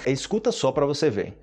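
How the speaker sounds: ordinary talk, nothing marked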